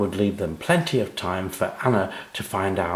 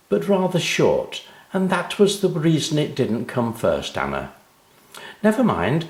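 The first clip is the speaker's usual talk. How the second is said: higher, kindly teacher; 6 semitones higher, 3 dB louder than usual. Acted. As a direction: explain gently like a teacher. Teacher voiced in story.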